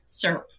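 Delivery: "syrup" squeezed into one syllable, like "surp"; one-syllable Texan syrup. Retold or imitated. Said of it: "there is only one syllable".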